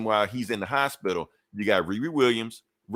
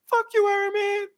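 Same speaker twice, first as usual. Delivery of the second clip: squeaky high jeering shout; mock crowd heckle; 4 dB louder than usual. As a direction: yell it way up high like an ungrateful bystander. Saved people curse Iron Man.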